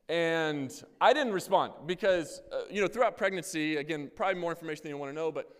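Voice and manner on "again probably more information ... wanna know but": normal talking voice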